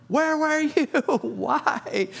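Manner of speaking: very high, aggrieved squeak; exasperated Peter. Retold or imitated. Peter finally let in, high exasperated complaint. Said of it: "you could just tell he's like".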